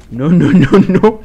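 loud, rising string of no's; alarmed cry. Real, not acted. Player panicking at a sudden threat in the game, alarmed cry.

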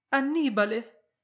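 high, loud cry of his name; alarmed exclamation. A narrator's reading. Wife seizes her husband's arm, crying his name in alarm. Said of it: "esclamò Dorabel".

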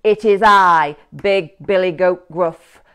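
lightly voiced big goat, loud and firm; a hint of a bold big goat. A narrator's reading. Big goat facing the troll, firm and loud. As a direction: announce yourself without fear.

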